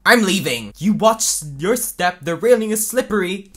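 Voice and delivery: heated shouting. Acted. Storming out past a slippery railing, heated shouting. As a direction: call it out forcefully. Acted heated exit and warning.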